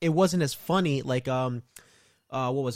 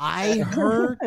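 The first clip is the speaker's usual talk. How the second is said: high, cracking voice from a cold; 7 semitones higher, 4 dB louder than usual. Real, not acted. Getting over a cold, voice cracking. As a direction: voice jumps up and cracks. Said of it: "my voice is cracked".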